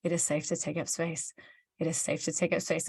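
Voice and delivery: soft, low self-reassurance; quiet mantra to yourself; inner self-talk. Retold or imitated. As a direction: say it softly, as if only in your head. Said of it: "I sit there in my mind saying".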